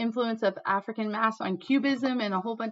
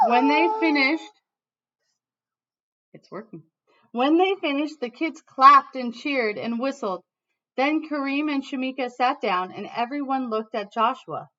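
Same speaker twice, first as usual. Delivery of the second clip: lively read-aloud storytelling voice; reading to children; 3 semitones higher, 5 dB louder than usual. Acted. Teacher reading a picture book to her class, lively storytelling.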